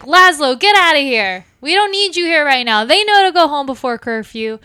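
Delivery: loud, high scolding; exasperated barkeep ordering someone out; irritated telling-off. Acted. Miriam tells sheriff off.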